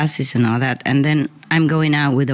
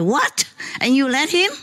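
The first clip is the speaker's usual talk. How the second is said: high, incredulous mock outrage; shocked disbelief, pitched well up; retold outrage; 9 semitones higher than usual. Scolding the taxi driver for giving his son a cigarette, high outraged disbelief. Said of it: "but I was so mad".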